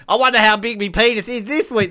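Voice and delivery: high mocking put-on voice; George Lazenby impression. Mocking the Bond actor in a silly voice. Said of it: "That's George Lazenby".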